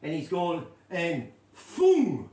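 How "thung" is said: thung with a lisp, louder; imitating a lisped thung; lisped punchline. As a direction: lisp the word thung as he did. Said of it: "like this".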